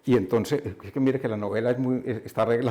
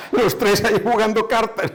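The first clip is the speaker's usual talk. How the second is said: laughing, high, loud repeat of the punchline; amused laughter; 8 semitones higher, 7 dB louder than usual. Real, not acted. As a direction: say it through a laugh, higher and louder. Laughing at the novel's absurd reveal.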